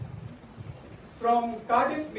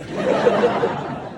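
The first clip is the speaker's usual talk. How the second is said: real laugh; 3 dB louder than usual. Real, not acted. Laughing at his own story.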